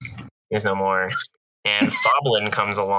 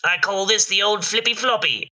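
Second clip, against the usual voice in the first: goblin voice; 10 semitones higher than usual. Acted. Goblin naming the style.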